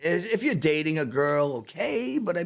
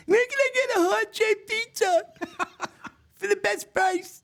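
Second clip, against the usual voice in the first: mock the cheapskate neighbor begging online; whiny Staten Island neighbor. Chef mocking Staten Island neighbors hunting cheap heart pizza, high whiny voice. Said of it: "Staten Island neighbors is".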